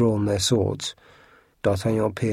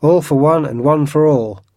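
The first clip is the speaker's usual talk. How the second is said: rallying cry; 5 semitones higher, 6 dB louder than usual. A narrator's reading.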